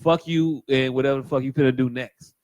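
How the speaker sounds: ordinary talk, nothing marked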